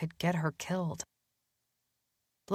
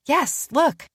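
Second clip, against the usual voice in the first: eager; 10 semitones higher, 6 dB louder than usual. Acted. Danny eagerly showing Matthew something.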